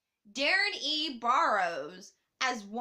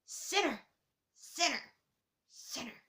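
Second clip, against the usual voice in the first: overbearing mother; 4 semitones higher than usual. Retold or imitated. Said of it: "overbearing mother who says".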